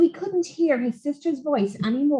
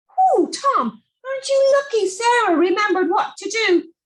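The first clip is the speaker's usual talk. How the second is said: high, light little sprite voice; a tiny magic creature, pleased; hedge sprite; 8 semitones higher than usual. Acted. Sprite on the boy's shoulder praising him, high and light.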